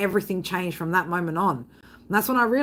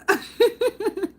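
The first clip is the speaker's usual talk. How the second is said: short high giggle; 12 semitones higher than usual. Real, not acted. Streamer giggling at her own joke about a song.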